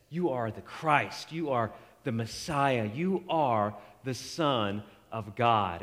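slow, reverent declaration; solemn confession. Retold or imitated. Peter answering Jesus, slow and reverent. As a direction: lay each title down slowly and softly.

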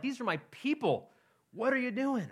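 high, outraged demand; God's indignant cry. Retold or imitated. Pastor voices God rebuking Israel's leaders, high and indignant. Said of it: "God's anger is like".

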